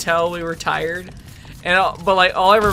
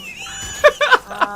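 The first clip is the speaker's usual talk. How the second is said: high laugh. Real, not acted. Laughing at friend's joke.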